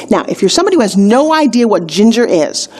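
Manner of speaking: ordinary talk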